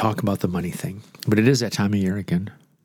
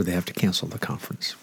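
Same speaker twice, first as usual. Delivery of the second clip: soft whispered punchline, much quieter; mock whisper; 3 semitones lower, 7 dB quieter, faster than usual. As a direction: hush it like a secret. Retelling the cancellation joke.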